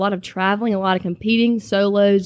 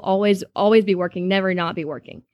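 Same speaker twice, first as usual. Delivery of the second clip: usual speaking voice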